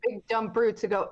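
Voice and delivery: ordinary talk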